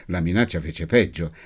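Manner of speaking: ordinary delivery, nothing marked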